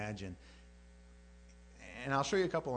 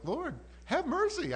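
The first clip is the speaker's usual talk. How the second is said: high, loud, drawn-out plea; acted desperate appeal; begging for mercy; 5 dB louder, slower than usual. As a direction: implore slowly at a high pitch. David begging mercy under chastening.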